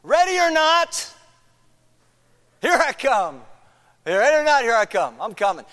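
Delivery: high, loud sing-song "Ready or not, here I come"; hide and seek seeker call. Retold or imitated. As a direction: call out like a kid who has finished counting. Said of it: "they usually say something like this".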